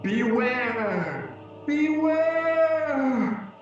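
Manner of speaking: rising, building ghostly cry; ghostly warning shout. Acted. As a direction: howl the warning as you fade. Marley's parting warning.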